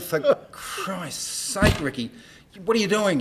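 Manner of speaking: high, slow drawn-out exasperation; exasperated. Retold or imitated. Manager scolding the singer in the boss's office, exasperated. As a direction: plead in disbelief.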